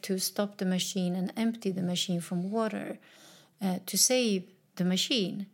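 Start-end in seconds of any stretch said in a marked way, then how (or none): none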